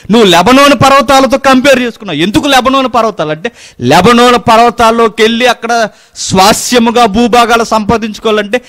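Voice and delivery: high, loud, fast preaching; fiery preaching. Acted. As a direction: drive the point home to the congregation. Preaching at full force. (ఉద్రేకంగా బిగ్గరగా ప్రసంగం)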